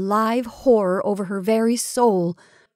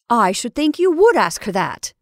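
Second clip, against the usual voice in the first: indignant; 3 semitones higher, 7 dB louder than usual. A narrator's reading. Indignant sister joins the scolding.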